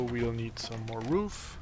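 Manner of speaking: normal talking voice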